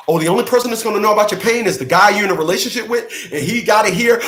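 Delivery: fast, high and loud, getting carried away; worked-up rant. Real, not acted. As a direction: let the rant run away with you. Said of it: "I went left for a second".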